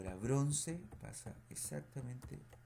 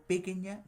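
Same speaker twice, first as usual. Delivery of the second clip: "pequeña" stressed as PÉ-que-ña; deliberately misstress "pequeña" on its first syllable; wrong stress, first syllable; 8 semitones higher, 7 dB louder than usual. Shown as a demonstration. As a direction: throw the weight onto the opening "pe" of "pequeña". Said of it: "no digo pequeña ni pequeña".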